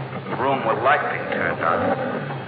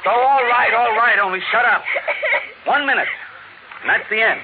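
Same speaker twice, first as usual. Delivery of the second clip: exasperated giving in; 8 semitones higher than usual. Acted. Father gives in to wailing children.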